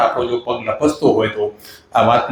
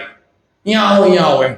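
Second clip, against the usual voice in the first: raised, higher urgent call; loud call; 4 semitones higher than usual. Retold or imitated. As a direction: summon them over loudly. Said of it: "એમ કરી જોરથી બૂમ પાડી".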